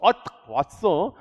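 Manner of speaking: Satan catching a churchgoer, mock surprise; voicing Satan. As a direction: sneer like the devil spotting his target. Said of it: "사단이 여러분들 노려서".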